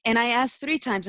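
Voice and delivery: ordinary delivery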